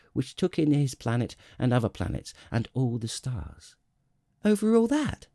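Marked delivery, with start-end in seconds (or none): 4.4-5.3 very high, incredulous rising question; asking little prince. A narrator's reading. Little prince questions the king about the stars, incredulous. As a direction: ask in amazement, pitched way up. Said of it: "asked the little prince".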